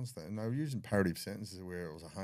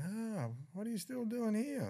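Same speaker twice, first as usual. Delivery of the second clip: high, slow, put-on cop voice, far up in pitch; a guard mocking the prisoner, slow and high; mock surprised cop; slower than usual. Cop taunting the prisoner who didn't run, high mock voice. Cop catching him still there.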